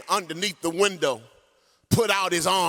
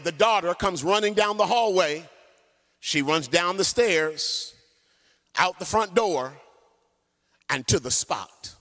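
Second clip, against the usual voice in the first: ordinary talk, nothing marked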